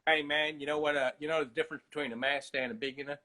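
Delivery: laid-back rapper sage, dropping low; easygoing rap-star impression. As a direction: share wisdom in a West Coast lilt.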